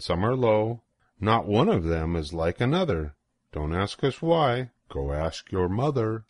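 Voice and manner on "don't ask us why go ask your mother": ordinary delivery, nothing marked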